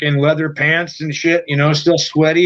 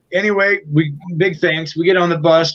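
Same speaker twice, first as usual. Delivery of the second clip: ordinary talk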